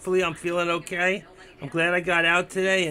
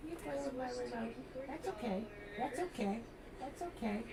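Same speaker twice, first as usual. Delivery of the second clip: talking to a dog; 7 semitones higher, 17 dB quieter than usual. Real, not acted. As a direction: coo softly like to a puppy. Talking to his pet.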